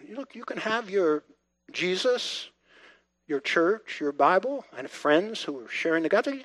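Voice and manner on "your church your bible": ordinary talk, nothing marked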